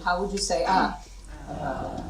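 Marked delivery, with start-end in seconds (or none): 1.5-2.0 low, soft, deflated Ah; shut-down ah. Shown as a demonstration. Teacher demonstrating a shutdown state, low deflated ah. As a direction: let the Ah sink out of you, lifeless. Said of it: "If you were in a shutdown mode".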